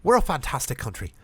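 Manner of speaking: put-on speech in an Irish accent; rousing speech in Irish accent. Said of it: "This is all Irish voices".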